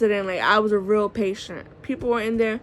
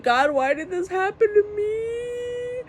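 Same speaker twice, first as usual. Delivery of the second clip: high, slow wailing plea; wailing in pain in the bumpy ambulance; crying and wailing; 11 semitones higher, slower than usual. Retold or imitated. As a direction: sob it out like a helpless baby. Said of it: "crying and wailing".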